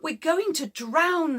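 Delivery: high, loud panicked cry; scared shout. A narrator's reading. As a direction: yell it in fear of drowning. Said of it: "Everybody was very scared".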